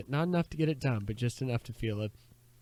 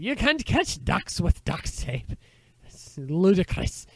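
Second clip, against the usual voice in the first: slow, loud Scottish scolding, pitched up; hammy Scottish accent, drawn out and indignant; Scottish uncle; 7 semitones higher, 8 dB louder, slower than usual. Acted. Uncle scolding the boy about ducks, loud Scottish accent. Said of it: "Now he's half Scottish".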